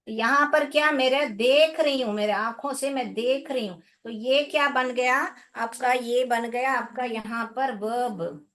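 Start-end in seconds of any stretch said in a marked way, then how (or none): none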